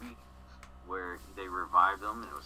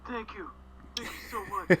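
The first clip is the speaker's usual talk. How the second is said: exaggerated thanks; 12 semitones higher, 4 dB louder than usual. Hawkeye voiced thanking doctor.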